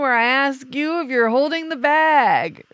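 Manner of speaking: loud, high, hammed-up announcer voice; segment announcer. Host introducing the couples gripes segment, showy announcer.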